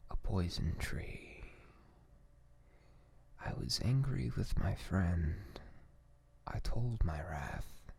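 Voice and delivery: gentle whispered recitation; calm poem reading. Acted. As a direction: read each line slowly and calmly, lingering on the ends. Reads a poem in ASMR voice.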